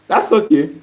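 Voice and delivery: usual speaking voice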